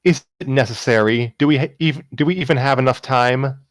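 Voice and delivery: reluctant protest. Acted. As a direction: push back, irritated but unsure. Umi protesting in the drama.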